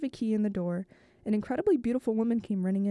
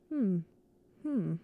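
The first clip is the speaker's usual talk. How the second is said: approving murmur. A narrator's reading. Narrator lightly voicing her approving murmur over the kitchen. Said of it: "nodding and murmuring approvingly".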